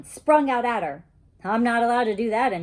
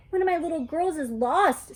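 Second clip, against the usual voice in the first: teacher's voice; 7 semitones higher than usual. Acted. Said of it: "a voice that sounded like Missus".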